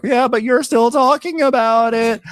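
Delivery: slow, high falsetto quip; drawn-out sassy falsetto; mock high voice. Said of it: "Angela's like".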